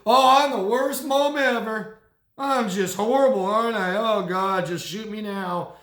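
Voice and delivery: hammed-up melodramatic despair; victim-playing mother. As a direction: overdo the self-pity like a martyred mom. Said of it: "would be the victim".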